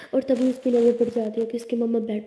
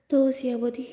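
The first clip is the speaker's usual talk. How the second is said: plain reading voice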